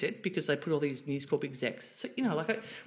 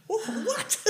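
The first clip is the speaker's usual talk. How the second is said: much higher, laughing disbelief; mock surprise. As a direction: gasp it in amused shock. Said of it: "I was like".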